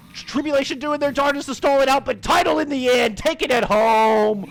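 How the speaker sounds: loud, excited victory call; climactic play-by-play. Real, not acted. Caster announces the match win to viewers, triumphant. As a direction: punch out the winning call. Calls the winning moment.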